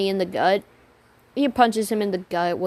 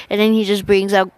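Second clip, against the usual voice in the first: ordinary delivery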